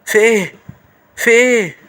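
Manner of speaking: sudden high-pitched alarm; panicked squeak of surprise; startled high yelp. Real, not acted. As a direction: jump out of your skin. Scared gamer reacts to granny.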